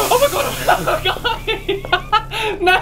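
high shrieking laughter through words; laughing in terror. Real, not acted. Terrified gamer to viewers, laughing hysterically. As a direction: laugh so you don't cry. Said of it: "If I don't laugh, I'll cry".

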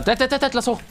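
normal talking voice

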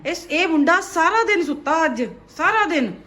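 loud exasperated scolding; dramatic loud aunty. Real, not acted. Mother loudly scolding sleepy son.

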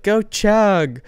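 high-pitched mock cheer; imitated yelling. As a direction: imitate a little cousin yelling from the stands. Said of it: "she kept yelling".

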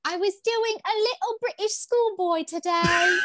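bad British schoolboy, high and drawn out; terrible British accent. Shown as a demonstration. Cohost giving the host a snippet of it, terrible British accent. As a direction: put on an awful British accent, slow and high-pitched. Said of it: "you have a terrible British accent".

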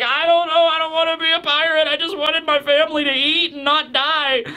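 put-on high, pleading, near-tearful old voice; desperate old man. Old man refusing to be a pirate, high pleading voice. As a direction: plead like a frightened old farmer. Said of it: "he's like".

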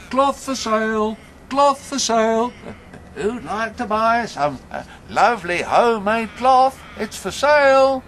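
put-on high, loud old peddler's cry; street vendor calling out. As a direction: call your wares down the street, high and loud. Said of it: "he called out".

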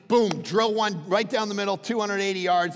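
ordinary delivery

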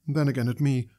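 ordinary delivery

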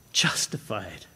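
low, soft, drawn-out justified; whisper-close justified, falling; hushed justified. Real, not acted. Savoring key word.

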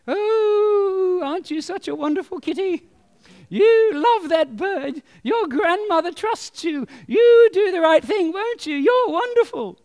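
high, slow, sugary angel voice; angel on the shoulder. Acted. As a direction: coax the cat sweetly in a high voice. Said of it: "there's the little angel".

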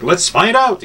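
high-pitched, rough stab at Scottish; a hint of proper Scot, voice lifted high; attempted Scottish accent. Scotsman taking up the beer challenge, attempted Scottish accent. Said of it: "very proper Scottish accent".

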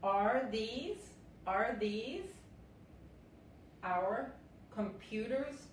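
ordinary delivery

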